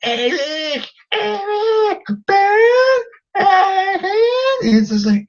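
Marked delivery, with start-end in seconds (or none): 0.0-4.0 very high screechy mock scream; shrill, held-out mock shrieks. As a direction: imitate a woman screeching names over and over. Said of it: "and she just screams".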